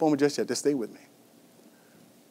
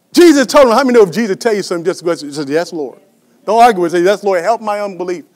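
loud, high burst, then easing; rapid, punched preacher's exhortation; forceful preaching; 4 semitones higher, 12 dB louder, faster than usual. Real, not acted. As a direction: exhort the congregation loudly.

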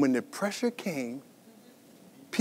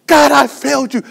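high, loud cry of guilt; tearful outcry, played with full force; acted weeping; 11 semitones higher, 12 dB louder than usual. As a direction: confess it to God in anguish. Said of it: "he's weeping".

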